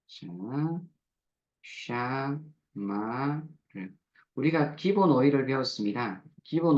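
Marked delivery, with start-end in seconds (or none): 1.9-3.5 아주 느리게 또박또박 "샤마르."; 한 음절씩 끊어 천천히 "샤마르". Shown as a demonstration. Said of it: "샤마르로 해볼게요". (아주 느린 시범 발음)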